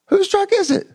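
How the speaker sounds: put-on very high, squeaky old father asking; bewildered dad. Father asking his son about the truck, high and bewildered. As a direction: squeak it out in disbelief.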